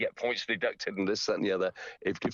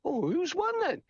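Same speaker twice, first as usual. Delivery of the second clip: wondering. Retold or imitated. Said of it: "And I'm thinking".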